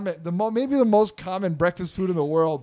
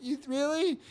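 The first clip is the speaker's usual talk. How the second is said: high, soft, hopeful child imitation; small pitched-up kid question; 11 semitones higher, 4 dB quieter than usual. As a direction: ask like a doubtful little boy. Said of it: "And he's like".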